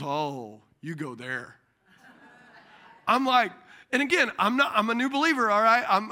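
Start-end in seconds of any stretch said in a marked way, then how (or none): 0.0-1.5 knowing repairman. Retold or imitated. Repairman voiced in story.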